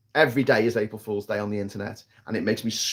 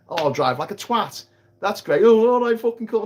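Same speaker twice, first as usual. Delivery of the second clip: higher, loud mocking boast; mocking show-off driver; 7 semitones higher, 3 dB louder than usual. Mocking reckless drivers, higher smug boast. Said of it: "They're like".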